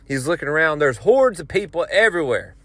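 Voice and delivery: ordinary delivery, nothing marked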